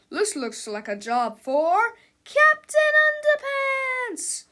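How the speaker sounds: slow build to a high, loud shout; big announcer build-up; superhero fanfare. A narrator's reading. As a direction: herald the hero, stretching out the name. Heralds the superhero's entrance.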